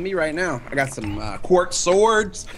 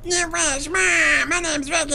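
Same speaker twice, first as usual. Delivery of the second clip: higher-pitched teasing imitation of his friend; mocking impression of friend; 9 semitones higher than usual. Mocking his friend Ricky to his face, higher silly imitation. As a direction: mimic his buddy as a silly whiner. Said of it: "Is that what I sound like to you?".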